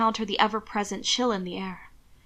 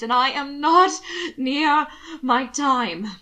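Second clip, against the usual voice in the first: louder, higher, slow indignant denial; flaring up; 4 semitones higher, 4 dB louder, slower than usual. Acted. Alana flares up at Hannibal, indignant. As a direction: flare up at him. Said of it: "she flared".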